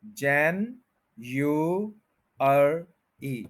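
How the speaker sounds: जैन, यू, अर, ई with a gap after each; syllable by syllable. Shown as a demonstration. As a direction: break it into four separate parts: जैन यू, अर, ई. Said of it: "four parts में ये word बोला जाता है". (जैन यू अर ई, अलग-अलग syllable)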